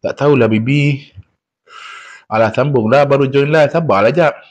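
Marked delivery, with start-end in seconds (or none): none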